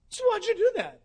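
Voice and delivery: high, loud, amazed question; doctor amazed at his calm patient; surprised doctor. Acted. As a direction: ask it like a baffled doctor.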